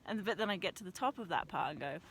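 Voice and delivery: ordinary talk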